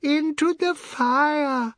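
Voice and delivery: very high, long-drawn cry; hilarity stretched into a high squeal; roared punchline. A narrator's reading. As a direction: wail the punchline out high and loud. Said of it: "they all roared".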